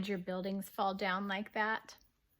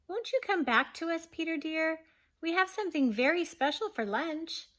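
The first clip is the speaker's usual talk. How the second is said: higher, kind mother's call; kindly calling; 7 semitones higher, 4 dB louder than usual. Acted. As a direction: coax him back sweetly. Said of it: "asking so kindly".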